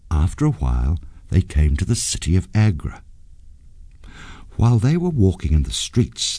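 ordinary delivery

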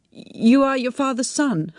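high, pained woman's voice; the words hurt to say. A narrator's reading. She tells Tim who he is, pained.

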